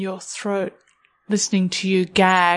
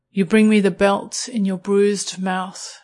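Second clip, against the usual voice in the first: plain reading voice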